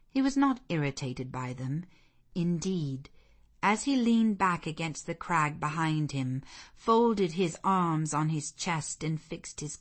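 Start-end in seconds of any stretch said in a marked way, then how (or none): none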